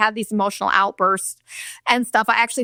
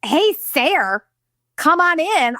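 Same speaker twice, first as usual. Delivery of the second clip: higher sarcastic echo of dad's greeting; mocking repeat; 6 semitones higher, slower than usual. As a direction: parrot him back mockingly. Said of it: "I'm like".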